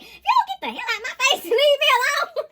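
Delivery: high, loud, laughing brush-off; laughing mock telling-off. Reader laughs while telling a negative Nancy to leave. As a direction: laugh while you shoo them away.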